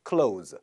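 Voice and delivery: clothes said low as "klohz"; one syllable clothes, straight from o to z; th left silent. Shown as a demonstration. Teacher repeating the rule, clothes with no th. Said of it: "티에이치 발음 안 하고요".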